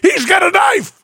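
high-pitched cry of alarm; comic panicked yell; alarmed shout. Acted. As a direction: shout a sudden warning, voice leaping up. Victim realizes attacker has a knife.

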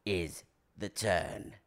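low, slow British actor's voice; Michael Caine. Acted. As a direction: drop low and draw out the last words. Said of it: "Michael Caine".

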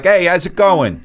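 put-on high, brash New York accent; New York accent, hammed-up; mock Jersey girl. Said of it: "You don't wanna go like".

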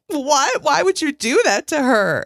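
high-pitched retold disbelief; incredulous protest. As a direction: object on her behalf, mock-appalled. Retold indignant reaction.

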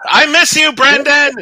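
loud yelling over the call; shouting. Real, not acted. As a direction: shout it as if he is far away. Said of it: "You don't have to scream".

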